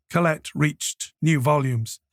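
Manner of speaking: usual speaking voice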